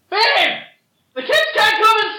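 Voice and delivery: high, squeaky mock call to the babe; hamming it up in a high, far-off voice; mock safe word. To the podcast hosts, a joke safe word in a silly high voice. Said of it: "Is that, is that the safe word?".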